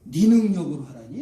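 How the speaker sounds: sharp, loud rebuking question; angry God. Acted. God scolds reluctant Moses, acted angry rebuke. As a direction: hit the opening hard, indignant. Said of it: "하나님 화를 내요".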